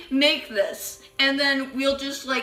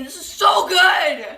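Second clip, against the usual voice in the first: excited; 5 semitones higher, 9 dB louder, slower than usual. Real, not acted. Emphatic praise.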